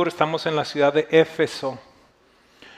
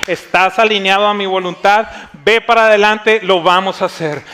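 raised, forceful preacher's voice; impassioned preaching; 5 semitones higher, 7 dB louder than usual. Real, not acted. Pastor urges the congregation to believe God, preaching with force. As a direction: build to a loud, high appeal for faith.

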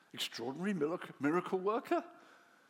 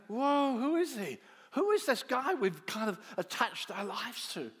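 awed exclaim; 6 semitones higher, 6 dB louder than usual. Acted. Awed disciples after the storm.

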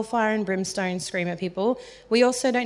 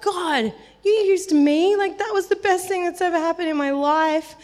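overwhelmed gratitude; 8 semitones higher, 4 dB louder than usual. Retold or imitated. Overwhelmed thanks to God.

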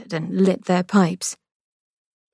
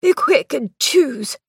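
lightly voiced brother, higher and slow; Victor, impatient; 7 semitones higher, slower than usual. A narrator's reading. Brother hurrying his sister to pick a corpse, mildly impatient. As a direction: hurry her along with a hint of edge.

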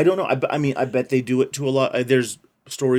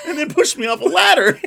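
mock child voice; 8 dB louder than usual.

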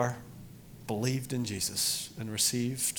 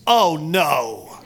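retold dismay; 5 semitones higher, 11 dB louder than usual. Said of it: "she goes".

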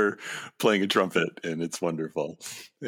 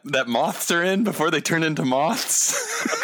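laughing; 12 semitones higher than usual. Real, not acted. Laughing while groping for cocoon.

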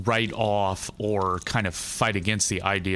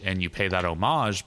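ordinary talk, nothing marked

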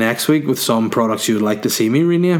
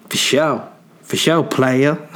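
mock swagger; 3 semitones higher, slower than usual. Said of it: "playful mock swagger".

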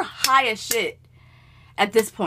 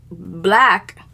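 ordinary talk

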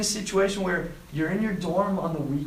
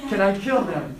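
louder, mock-eager begging; overexcited; 3 semitones higher, 5 dB louder than usual. King asking the question twice, overexcited. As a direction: playfully act the too-keen king. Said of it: "a little bit too excited".

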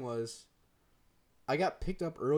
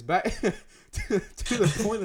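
laughing; 7 semitones higher, 7 dB louder than usual. Real, not acted. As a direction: talk through giggles. Cracking up while resuming story.

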